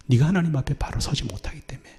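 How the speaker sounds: fast, hushed indictment; a voiceless hurried rebuke; rushed whisper. Real, not acted. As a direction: whisper it quickly and urgently.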